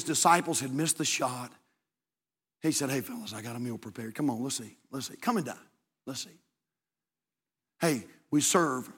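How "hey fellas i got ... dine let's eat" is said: tender invitation. Acted. Jesus inviting disciples to eat.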